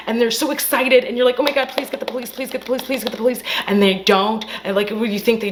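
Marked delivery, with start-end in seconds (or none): 1.4-3.4 high-pitched urgent begging; mock frantic pleading. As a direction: repeat it like a breathless plea. Acting out viewer begging for police.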